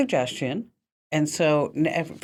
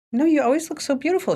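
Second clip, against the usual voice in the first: gushing compliment; 10 semitones higher, faster than usual. Retold or imitated. Compliments Paula in retold scene.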